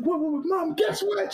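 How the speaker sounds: high-pitched frantic back-and-forth; very high, urgent excitement; excited phone exchange. Retold or imitated. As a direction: squeal it eagerly down the phone. Acted excited phone exchange with mom.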